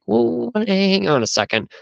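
high-pitched mock alarm; retold startled interruption. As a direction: act out halting someone mid-conversation. Dreamer interrupting his grandfather.

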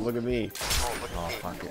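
normal talking voice

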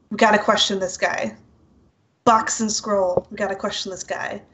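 normal talking voice

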